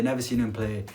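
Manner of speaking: ordinary talk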